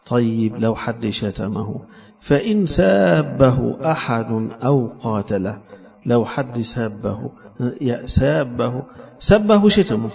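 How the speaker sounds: ordinary delivery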